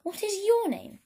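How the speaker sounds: eager question. Acted. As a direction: ask eagerly and high.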